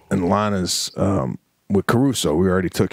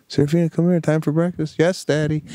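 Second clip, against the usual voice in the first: sweet singsong morning call; 8 semitones higher than usual. Retold or imitated. Morning exchange with daughter.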